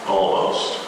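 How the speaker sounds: plain reading voice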